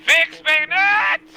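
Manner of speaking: high, slow bellowed battle order; gruff barked parade orders. Acted. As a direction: bellow it across the field.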